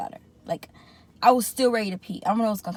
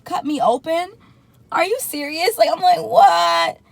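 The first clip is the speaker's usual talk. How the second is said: high, incredulous questioning; retold disbelief; 8 semitones higher, 7 dB louder, slower than usual. Patient to the surgical team, incredulous and high. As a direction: build to a loud, high "what".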